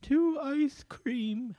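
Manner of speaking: high, drawn-out mock British lady; British accent in a high falsetto; British woman accent. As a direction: play a British woman, slow and high-pitched. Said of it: "a woman with a British accent".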